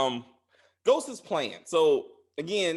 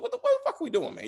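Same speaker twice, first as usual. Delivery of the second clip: high-pitched disbelieving outcry; squealing what-are-we-doing exasperation; exasperated outburst; faster than usual. Retold or imitated. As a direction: throw up your hands in disbelief. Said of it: "I was like".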